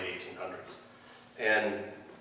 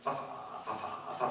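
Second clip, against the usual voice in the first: stuttering; 3 semitones higher, 7 dB quieter than usual. Retold or imitated. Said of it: "I stutter".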